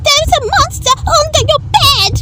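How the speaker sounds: high, fast squealing warning; Pig crying out. A narrator's reading. Pig warning Duck, high and fast in panic. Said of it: "cried Pig".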